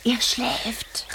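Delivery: hushed whisper so he sleeps on; prank whisper. Acted. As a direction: breathe it, barely voiced. Elephant child asleep nearby. (geflüstert, ganz leise)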